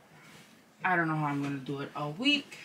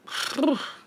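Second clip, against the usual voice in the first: heavy sigh; 10 semitones higher than usual. Real, not acted. Out of shape after stairs.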